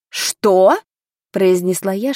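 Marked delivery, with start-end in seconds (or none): 0.1-0.8 louder, shocked question. A narrator's reading. Lady shocked by the tutor's tone, startled. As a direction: react in disbelief at the rudeness. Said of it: "шокированная тоном человека".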